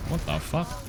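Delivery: sudden high-pitched disbelief; shocked. Real, not acted. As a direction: blurt it out in shock. Coach shocked by team's turnaround.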